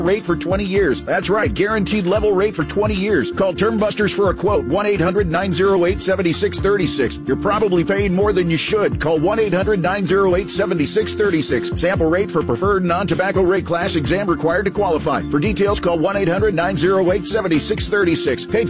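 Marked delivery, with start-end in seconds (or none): none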